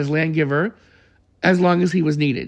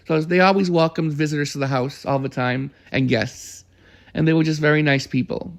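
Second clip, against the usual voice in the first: normal talking voice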